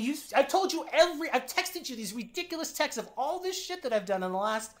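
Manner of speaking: high, loud disbelieving protest; incredulous retort. Retold or imitated. Woman answers Sandy asking her out, incredulous. Acted reply to being asked out.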